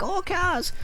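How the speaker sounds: put-on high excited little kid; kid impression. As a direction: squeal it like an excited child. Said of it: "That's my kid impression".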